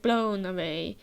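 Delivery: ordinary talk, nothing marked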